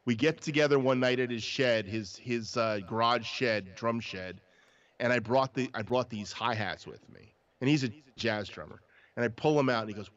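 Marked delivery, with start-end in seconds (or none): none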